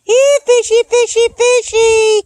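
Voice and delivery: high sing-song calling; talking to fish. Real, not acted. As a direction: coax the fish, high and loud.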